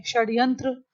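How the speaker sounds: ordinary delivery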